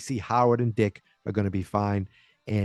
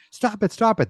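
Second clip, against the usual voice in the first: women pleading; 11 semitones higher than usual. Retold or imitated. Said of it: "They're like".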